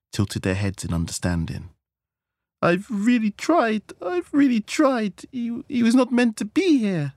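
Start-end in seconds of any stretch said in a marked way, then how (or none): 2.6-7.1 distraught wailing. Acted. Wife laments to her friends, distraught.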